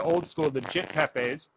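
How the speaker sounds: glitchy robotic mic sound; robot voice from a bad mic. Real, not acted. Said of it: "You sound like a robot".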